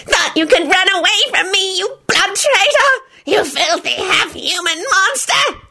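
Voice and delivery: venomous high creature voice; shrill, hateful elf tirade; high-pitched screech. A narrator's reading. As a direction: screech insults at a traitor. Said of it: "screeched in a high-pitched voice".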